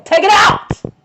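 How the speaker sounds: loud, high yell at full force; demanding shout. Retold or imitated. Patient to dentist offering a root canal, demanding shout. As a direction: shout the order, refusing any other option.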